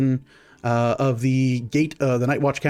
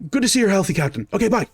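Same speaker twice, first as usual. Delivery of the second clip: eager private; 7 semitones higher, faster than usual. Acted. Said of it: "He goes".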